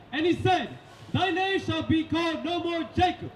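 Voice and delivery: ordinary delivery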